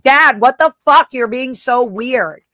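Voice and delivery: retold shocked yell; scared angry yell. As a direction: shout it, startled and furious. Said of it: "it scared me and I slammed the door".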